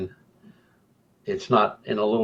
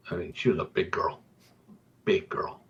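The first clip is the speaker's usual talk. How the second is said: whispered aside; 3 semitones lower, 7 dB quieter than usual. Real, not acted. Telling the audience about the sister, whispered. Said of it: "I'm gonna say it one more time".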